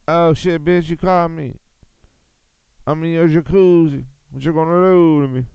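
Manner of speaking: high, loud cartoon bear voice; voicing the bear. Streamer voicing the bear in the jacuzzi photo, high and loud. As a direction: play the bear caught in the jacuzzi, cocky. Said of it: "He's like".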